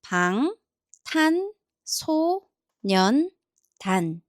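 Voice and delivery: 방, 탄, 소, 년, 단 as five isolated syllables. Shown as a demonstration. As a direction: sound out each syllable with a clear pause between. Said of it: "Let's put them together".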